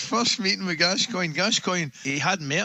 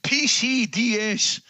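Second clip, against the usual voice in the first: slow, higher-pitched mock holler; imitated shout; 5 semitones higher, 3 dB louder, much slower than usual. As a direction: stretch out each letter, calling it across. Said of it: "That's what Gascoigne was shouting".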